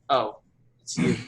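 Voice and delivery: ordinary talk, nothing marked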